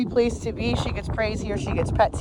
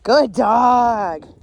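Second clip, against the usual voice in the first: louder, drawn-out praise; 4 dB louder than usual. Real, not acted. Trainer rewarding dog back in position, drawn-out praise. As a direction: reward her fully.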